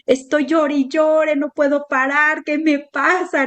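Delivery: slow, mildly plaintive imitation of a patient; imitated tearful complaint. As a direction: mimic an upset caller dragging out the words. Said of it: "me habla y me dice".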